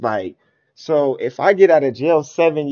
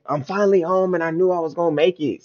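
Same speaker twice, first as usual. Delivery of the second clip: imitated elation; 5 semitones higher, faster than usual. Voicing the freed rapper's thoughts.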